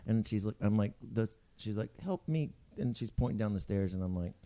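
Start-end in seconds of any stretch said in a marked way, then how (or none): 2.0-2.5 higher, softer mimicked plea; pleading stranger. Lady with a baby points down the stairs, pleading. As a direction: imitate her meek request.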